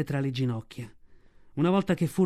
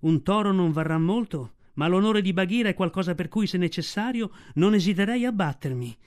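ordinary delivery

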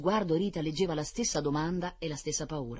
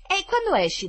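high, louder eager question; eager little boy; 8 dB louder than usual. A narrator's reading. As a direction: ask high and loud like a keen child. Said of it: "domandò il biondino".

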